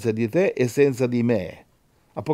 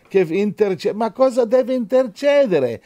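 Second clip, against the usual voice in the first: high, insistent preacher's challenge; impassioned pulpit rebuke; forceful preaching; 8 semitones higher, 4 dB louder than usual. Real, not acted. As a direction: preach it high, driving the question home.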